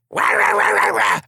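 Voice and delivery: high, loud mock ranting noise; mock loud yelling. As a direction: imitate loud Americans yammering. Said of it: "It would be too loud".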